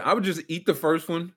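normal talking voice

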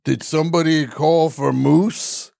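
lightly put-on bar announcer, drawn out; a slow, slightly put-on herald; different voice. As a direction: slowly announce someone's arrival, rising into the question. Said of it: "do a different voice".

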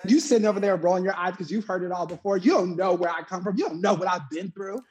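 high-pitched mock tirade; acted angry monologue. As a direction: recite it like a wound-up TV host telling someone off. Reciting Tyra's angry monologue.

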